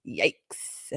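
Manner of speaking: ordinary talk, nothing marked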